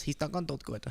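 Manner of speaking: very soft put-on silly accent; mock him in a hushed, goofy voice; Eric Trump. Host mocking a son talking of daddy, silly soft accent. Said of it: "that was the accent I chose".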